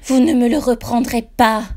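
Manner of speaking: loud, high scream. A narrator's reading. Ghost mother screaming at the narrator. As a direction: scream it in desperation. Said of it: "Elle crie".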